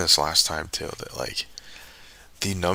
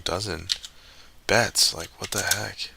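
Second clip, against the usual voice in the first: normal talking voice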